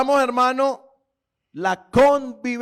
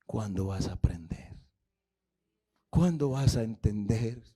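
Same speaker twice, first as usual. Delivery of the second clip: much softer and lower, a weary plea; sorrowful voice of the Lord; 9 semitones lower, 8 dB quieter than usual. Retold or imitated. Preacher voicing the Lord pleading with the proud man. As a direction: sigh it out like a patient father.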